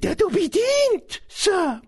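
very high-pitched elf voice; high, earnest creature voice; Dobby, dutiful house-elf. Acted. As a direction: explain it as a servile little elf.

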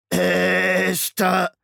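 laut, höher, knarzende Maschinenstimme; knarzend. A narrator's reading. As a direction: knarz den Namen wie eine rostige Maschine. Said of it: "knarzte Shrike". (Shrike ruft Hester knarzend und laut)

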